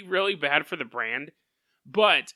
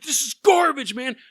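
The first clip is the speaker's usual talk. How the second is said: loud, higher, disgusted yell; angry outburst; 7 semitones higher, 6 dB louder than usual. Retold or imitated. Furious customer in the Colonel suit yelling at the kids, loud rant. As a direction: roar your disgust at the meal. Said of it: "'cause he was cussing nonstop".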